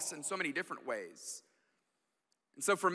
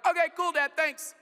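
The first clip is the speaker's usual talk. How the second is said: higher, dismissive quick thanks; offhand kid; 10 semitones higher, 5 dB louder than usual. Retold or imitated. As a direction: toss it off and go back to screens. Kids shrug it off.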